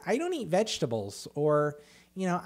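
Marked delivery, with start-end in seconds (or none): 0.1-1.3 high-pitched picky eater; mock whining. Mimicking people who gripe about food, mock whiny. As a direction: whine like a fussy child, much higher. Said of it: "listening to somebody bitch about".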